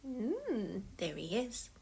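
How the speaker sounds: ordinary delivery, nothing marked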